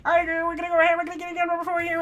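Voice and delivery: high Kermit-style auction call; Kermit auctioneer. Acted. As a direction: frog puppet voice, fast. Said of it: "can I do Auctioneer as Kermit".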